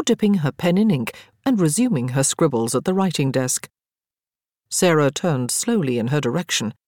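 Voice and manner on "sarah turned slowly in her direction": plain reading voice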